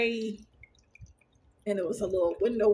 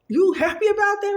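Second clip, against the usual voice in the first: high, loud baffled question; mock the husband's disbelief; imitating puzzled husband; 11 semitones higher, 7 dB louder than usual. Husband asking if she's happy about dying, high loud baffled. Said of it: "He was like".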